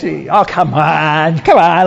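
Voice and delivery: drawn-out, cajoling plea; coaxing. Acted. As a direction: coax a reluctant congregation, stretching the words. Coaxing a stiff congregation to talk.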